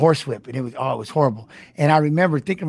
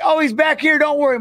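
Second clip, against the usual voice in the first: much higher, breezy fan calling out; a hint of an easygoing fan; crowd member reassuring; 11 semitones higher than usual. Fans at the UFC event tell the dads not to worry, in a lightly voiced breezy tone.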